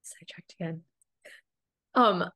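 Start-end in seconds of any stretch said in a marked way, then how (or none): 0.0-0.8 barely voiced muttered admission; a quick whisper under the breath; whispered aside. Real, not acted. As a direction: whisper it to yourself, sheepish. Aside to self after tangent.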